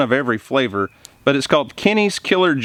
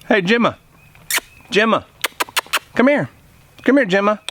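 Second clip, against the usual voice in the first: higher calling voice to dog; 7 semitones higher than usual. Real, not acted. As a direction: call her over, bright and high.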